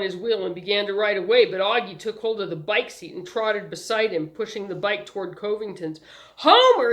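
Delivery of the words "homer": high, loud call of the name; shouted. A narrator's reading. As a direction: grab his attention with a yell. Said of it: "he shouted".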